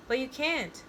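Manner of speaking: mild protesting yell. A narrator's reading. Kale objects to John leaving early, mild protesting yell. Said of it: "yells Kale".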